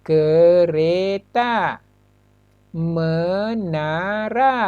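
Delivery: "kereta" and "menara" drawn out very slowly, syllable by syllable; slow syllable demo. Shown as a demonstration. Teacher showing pupils three syllables, very slow. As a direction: stretch "kereta" and "menara" so each syllable is heard. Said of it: "tiga suku kata".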